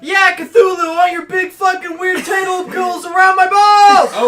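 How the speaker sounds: high, loud, drawn-out bellow; mock shout. Host bellowing a lewd plea to Cthulhu, mock shout. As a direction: holler it in over-the-top mock ecstasy.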